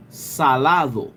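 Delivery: salado said slowly with every syllable; slow, full Spanish salado; careful Spanish. Shown as a demonstration. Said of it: "salty in Spanish is".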